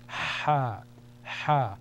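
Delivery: Ha with a long push of air from the throat; Arabic letter sound demo; sustained breathy ha. Shown as a demonstration. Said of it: "a sustained expulsion of air".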